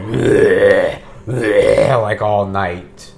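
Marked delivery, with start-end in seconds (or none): 0.0-2.0 short growled bleh sounds, louder; guttural bleh, bleh; monster growl. Shown as a demonstration. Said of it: "all I really need to do is, like, growl".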